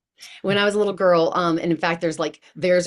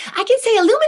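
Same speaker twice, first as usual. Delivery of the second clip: put-on much higher and louder, a proud small child blurting out; little girl piping up; 8 dB louder, much faster than usual. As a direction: chirp it out like an eager little kid. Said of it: "you hear me pipe in".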